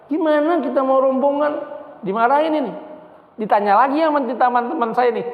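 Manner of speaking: high-pitched indignant wife, retold; angry. Wife hears the visa never came, angry. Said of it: "Tambah marah".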